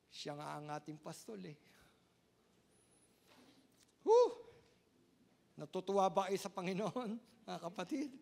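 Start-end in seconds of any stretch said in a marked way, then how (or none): 4.0-4.3 high, loud exclaimed whew. Real, not acted. Pastor catching his breath mid-sermon, loud whew. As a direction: let out a big whoosh of relief. Preacher exhales after climax.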